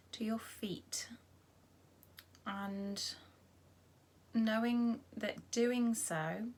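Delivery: gentle meditation voice with long pauses; guided body scan. Acted. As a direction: guide them unhurriedly through the body, leaving space after each phrase.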